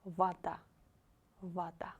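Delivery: Вода said vata, unstressed о as а; voda with а. Shown as a demonstration. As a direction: reduce the first о of Вода to a. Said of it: "Because O is not stressed".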